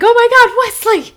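high, loud delighted squeal; excited recognition. Retold or imitated. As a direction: burst out in sudden delight. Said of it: "I'm like".

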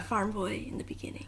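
soft whispered aside. Retold or imitated. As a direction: whisper it like a secret. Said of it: "He was very quiet".

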